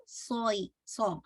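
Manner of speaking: ordinary talk, nothing marked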